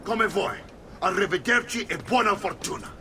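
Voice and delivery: bitter, offended goodbye; angry dismissal. Acted. Uncle storms off after nephew refuses his training, angry.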